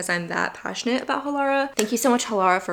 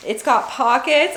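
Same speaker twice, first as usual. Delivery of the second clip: excited; 5 semitones higher, 7 dB louder than usual. Real, not acted. Delighted discovery of pockets.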